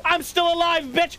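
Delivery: triumphant yell. Real, not acted. Surviving fight, gloating.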